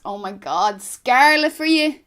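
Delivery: Dublin slang exclamation, loud, drawn-out 'scarlet'; thick Dublin accent. Shown as a demonstration. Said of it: "in that thick Dublin accent".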